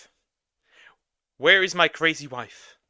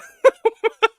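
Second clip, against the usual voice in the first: sudden burst of laughter; loud high laugh; 7 dB louder than usual. Real, not acted. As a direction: crack up loudly. Laughs at approved pic.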